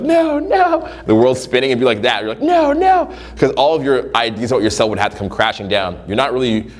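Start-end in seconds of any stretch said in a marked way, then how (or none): 0.0-0.9 high, laughing no no of a panicked Scrooge; mock Scrooge panic. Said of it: "like, Christmas Carol where, like, Scrooge". 2.4-3.1 high, laughing no no of a panicked Scrooge; mock Scrooge panic. Imitating the panicked listener.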